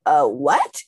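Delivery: mock double take, swooping up; exaggerated confused question; baffled subconscious mind. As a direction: react in comic disbelief. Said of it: "Your subconscious mind's like".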